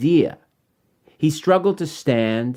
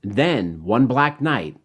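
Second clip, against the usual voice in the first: measured rhyming storybook read over music; story narration. Acted. Author reading his rhyming book to children, gentle storytelling. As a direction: read it like a bedtime picture book.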